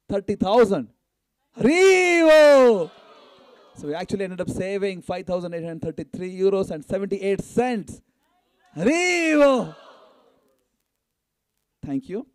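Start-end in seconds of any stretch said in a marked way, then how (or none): 1.6-2.9 high, loud, stretched-out "Haribo"; joyful shouted cheer. Real, not acted. Cheering the donation total to the crowd, long joyful shout. 8.7-9.7 high, loud, stretched-out "Haribo"; joyful shouted cheer. Real, not acted. Cheering the donation total to the crowd, long joyful shout.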